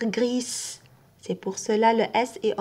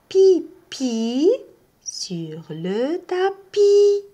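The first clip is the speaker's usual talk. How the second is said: "pipi" popped high and loud, "tapis" drawn out; playful emphasis; 5 semitones higher, 4 dB louder, much slower than usual. Acted. Teacher amuses learners with the naughty cat. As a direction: tease out the naughty word. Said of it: "teacher tells funny story".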